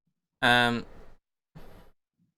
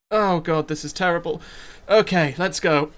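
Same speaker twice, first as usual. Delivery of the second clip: higher, louder burst of dismay, then resolve; psyching himself up; 4 semitones higher, 4 dB louder than usual. Acted. Reluctant town leader to his companion, psyching himself up. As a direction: groan at the task, then push yourself into it.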